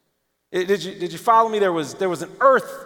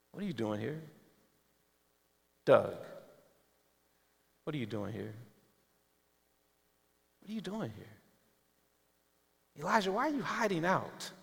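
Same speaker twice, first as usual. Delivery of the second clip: much softer and lower, hushed with long pauses; gentle whisper; 3 semitones lower, 12 dB quieter than usual. Acted. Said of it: "God just gently whispers in your spirit".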